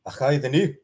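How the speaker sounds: Scottish accent with a rising end; a second go at the Scottish accent. Shown as a demonstration. Scottish accent repeated for chat. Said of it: "there's my Scottish accent".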